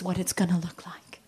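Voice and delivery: mock-dramatic whispered voiceover. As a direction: whisper it like a TV reveal. Imitating Bake Off voiceover.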